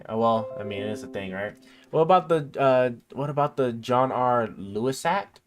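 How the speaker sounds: normal talking voice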